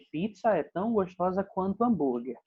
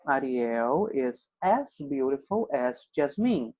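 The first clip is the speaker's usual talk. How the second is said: slow, measured English example; slow model sentence; 3 semitones lower, slower than usual. Shown as a demonstration. Teacher showing students a princess comparison, slowly and deliberately. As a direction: say it slowly so learners can follow.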